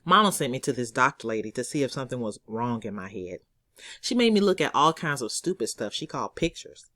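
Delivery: usual speaking voice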